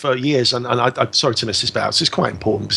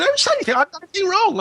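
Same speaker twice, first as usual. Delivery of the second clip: retelling his own squeaky, panicked denial in a much higher voice; panicked high protest; much faster than usual. Retold or imitated. To the shouting officer, a squeaky, panicked denial. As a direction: pitch shoots way up in disbelief. Protesting innocence in police interview.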